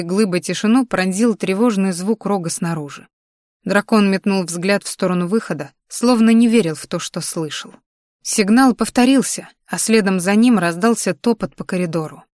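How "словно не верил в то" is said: ordinary delivery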